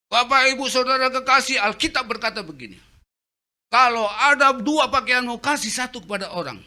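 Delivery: loud, high booming sermon voice; preacher in full flow. Retold or imitated. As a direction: thunder it out from the pulpit. Pastor preaching within the story.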